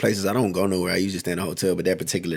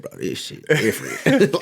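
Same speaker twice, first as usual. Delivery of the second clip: slow, high, amazed; drawn-out disbelief at something new; stunned reaction; 7 semitones higher than usual. Retold or imitated. Reaction to strange Boston accent.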